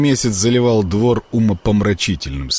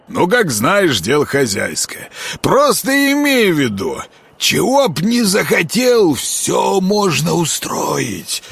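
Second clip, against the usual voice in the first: plain reading voice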